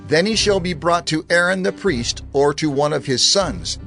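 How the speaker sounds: usual speaking voice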